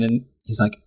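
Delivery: normal talking voice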